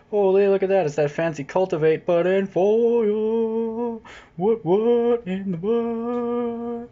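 high, slow, drawn-out put-on squeal; amazed mock squeal. Seeing the foil Cultivate, a drawn-out high amazed squeal. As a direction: gasp at it like a stunned kid.

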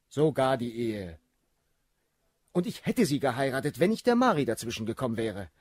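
fast, loud, careless baron; rushed excuse. Acted. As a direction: rattle it off carelessly.

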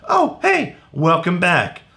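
high, bright "Oh, hey"; cheerful greeting. Acted. Teacher welcoming kids back, high and cheerful. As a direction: greet them with a big high hello.

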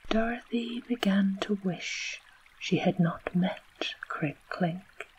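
ordinary delivery

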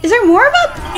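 high-pitched exasperated disbelief; real disbelief. Real, not acted. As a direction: blurt it out, incredulous at the game. Dismay at surviving enemies.

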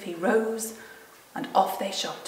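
ordinary delivery

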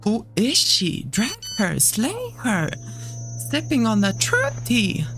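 sassy hype voice. Pretend. Hyping a game character.

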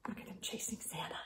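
quick hushed aside; rushed whispered aside; fast whisper. Real, not acted. Whispered aside about picture.

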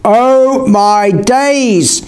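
high, long-drawn exclamation; mock scream. A narrator's reading. Voice behind the pair startles them, drawn-out restrained scream. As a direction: stretch it out high, a scream without full volume. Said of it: "a voice screamed behind us".

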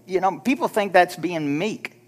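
ordinary talk, nothing marked

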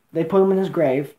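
usual speaking voice

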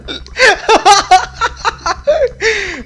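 long, very high-pitched laugh; real laughing fit. Real, not acted. Cracking up at dad's insult, high laughing fit. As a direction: burst into helpless high laughter. Cracks up after retold scolding.

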